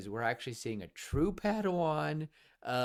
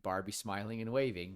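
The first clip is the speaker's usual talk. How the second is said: ordinary delivery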